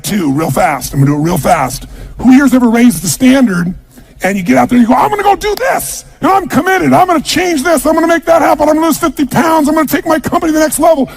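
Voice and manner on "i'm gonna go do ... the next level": very high, fired-up vow; all excited. Acted. Seminar-goer vowing to raise the standard, all excited. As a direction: pump yourself up, voice pitched way up. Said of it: "And you're all excited".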